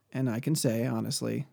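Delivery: ordinary talk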